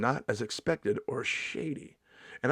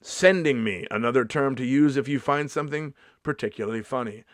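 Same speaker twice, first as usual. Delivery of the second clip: ordinary delivery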